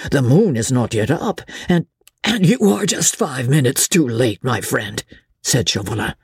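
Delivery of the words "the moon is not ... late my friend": ordinary delivery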